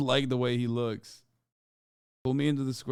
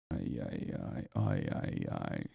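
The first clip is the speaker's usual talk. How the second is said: much lower, softer sinking ay ay ay; deep groaning ay; 10 dB quieter, slower than usual. Pretend. Streamer groaning at the British joke in a deep low ay ay. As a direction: let the voice drop into a low groan.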